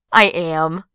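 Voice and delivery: ordinary delivery, nothing marked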